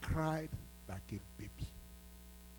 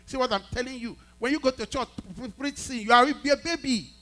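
higher, louder climactic preaching; preacher rebuking immature churchgoers, higher and louder; preaching climax; 10 semitones higher, 11 dB louder than usual. Real, not acted. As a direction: drive the point home at full force.

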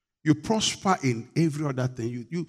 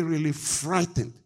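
ordinary delivery

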